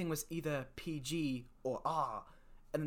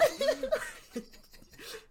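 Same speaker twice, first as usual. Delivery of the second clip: squealing laugh high above the voice; a real high-pitched laugh; high burst of laughter; 5 dB louder than usual. Real, not acted. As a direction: crack up in a shrieking giggle. Laughing about the podcast name.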